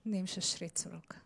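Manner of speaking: whispered answer to the congregation. Shown as a demonstration. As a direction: let it fall to a whisper. Quiet answer after loud example.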